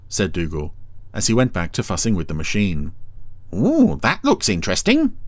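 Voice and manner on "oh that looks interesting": high eager snail voice; Brian voice. A narrator's reading. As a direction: sound high and curious. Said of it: "said Brian".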